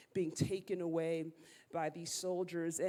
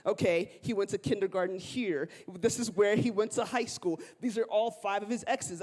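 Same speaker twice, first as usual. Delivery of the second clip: loud, eager rundown of a stranger's life; eager little sister; 2 semitones higher, 9 dB louder than usual. Retold or imitated. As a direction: rattle off the dossier like a proud sister. Sister voiced reporting dossier.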